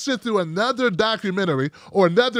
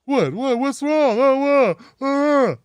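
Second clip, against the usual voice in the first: mock-innocent, higher-pitched playing dumb; 5 semitones higher, slower than usual. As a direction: act baffled, like you have no idea what you did. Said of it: "They like pretending they don't know why".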